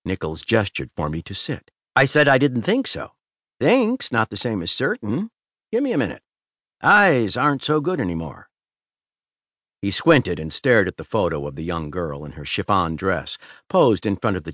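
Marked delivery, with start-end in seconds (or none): 2.0-8.5 a hint of a sly old convict, higher; casual, stalling con, voice pitched up; mild drawling prisoner taking his time; lightly voiced inmate.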